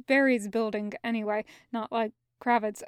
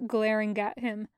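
ordinary delivery

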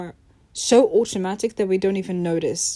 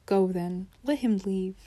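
usual speaking voice

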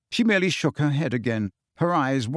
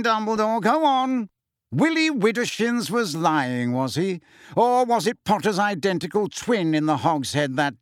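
mocking Fudge; 7 semitones higher than usual. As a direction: taunt Dumbledore gleefully. Fudge mocks Dumbledore.

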